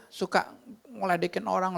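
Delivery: ordinary talk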